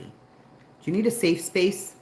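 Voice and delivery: high, loud, drawn-out mock question; mock them with exaggerated, slow concern; loud put-on concern. Mocking snowflake culture.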